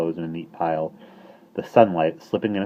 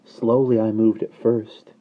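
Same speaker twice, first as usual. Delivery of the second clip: slow telling; 4 semitones higher, 6 dB louder, slower than usual. Acted.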